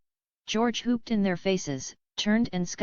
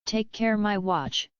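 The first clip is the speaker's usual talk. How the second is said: lightly called out, slower on the last words; a hint of a flustered vicar calling out; mild cry; slower than usual. A narrator's reading. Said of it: "cried Mister Beebe".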